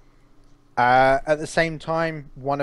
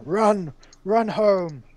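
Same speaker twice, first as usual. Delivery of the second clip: high, loud call to his men; shouting; 9 semitones higher, 4 dB louder, much slower than usual. Acted. Bran, losing the fight, orders his men to flee, shouting. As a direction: shout it out with a long, falling cry. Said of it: "he shouts to his men".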